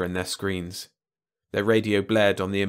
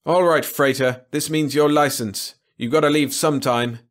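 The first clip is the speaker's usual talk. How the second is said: loud, raised patrol officer's warning; threatening radio call; 5 semitones higher, 6 dB louder than usual. A narrator's reading. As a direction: call a threat after the escaping ship. Patrol threatens freighter after losing it.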